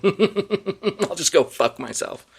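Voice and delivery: slow, high words through real laughter; laughing. Real, not acted. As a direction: laugh at yourself as you say it. Laughing at his own mistake.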